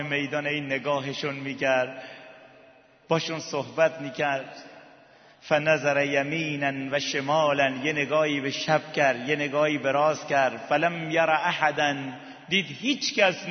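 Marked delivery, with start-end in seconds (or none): none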